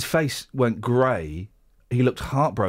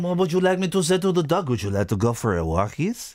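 put-on French accent. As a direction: tease the dog in a playful French accent. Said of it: "I can speak with your French accent".

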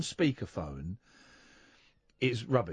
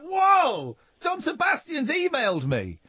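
excited; 11 semitones higher, 4 dB louder, slower than usual. Retold or imitated. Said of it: "I'm like".

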